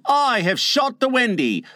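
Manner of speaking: high, proud declaration easing toward the end; proud boast. Acted. Tootles boasts to the other boys, proud. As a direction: start high and proud, then settle. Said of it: "he cried proudly".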